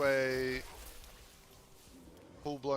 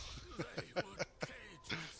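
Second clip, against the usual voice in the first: soft laugh; 7 semitones higher, 8 dB quieter than usual. Real, not acted. Laughs at his own remark.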